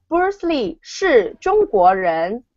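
"Bruce Lee 是中国人" said slowly for repeating; 慢速示范句子; slow model sentence. Shown as a demonstration. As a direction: model it slowly so students can copy. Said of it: "can you say that?".

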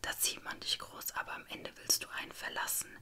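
ordinary delivery

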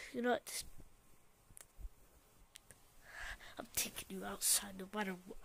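whispered, fast and low; menacing whisper. Acted. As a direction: hiss the threat close. Whispered threat.

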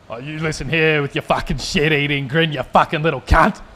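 put-on a stern Aussie dad laying into his boy; Australian dad scolding. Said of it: "That's like a dad talking to his son".

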